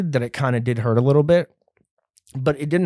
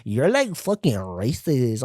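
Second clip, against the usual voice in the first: mock lady accusing; 4 dB quieter, slower than usual. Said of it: "this lady was like".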